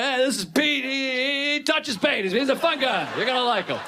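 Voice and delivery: high-pitched, chummy introduction; cheery introduction. Acted. Comic introduces dim buddy Pete to others, cheery mock introduction.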